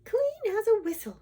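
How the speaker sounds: high, satisfied sigh of a line; pleased story-princess voice, high. A narrator's reading. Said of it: "she sighed".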